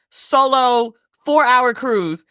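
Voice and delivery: slow, weighty stress on "four-hour cruise"; stretched emphasis. Real, not acted. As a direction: lean on "solo" and "four-hour cruise" in disbelief. Stressing she was alone.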